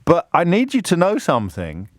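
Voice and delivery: ordinary talk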